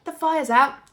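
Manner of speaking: put-on high, loud proud boy; boy proudly declaring the fire out; proud announcement. A narrator's reading. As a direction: announce it like a triumph. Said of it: "George announced proudly".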